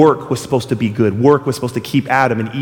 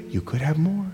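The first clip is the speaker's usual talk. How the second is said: seductive tempter; 10 dB quieter than usual. Acted. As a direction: lure them in quietly. Tempter repeats lure.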